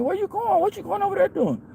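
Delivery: a high, disbelieving voice, like friends who can't believe what he's about to do; incredulous friends. Retold or imitated. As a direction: play the friends staring in disbelief.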